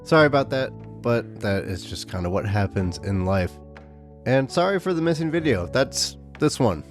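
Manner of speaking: ordinary delivery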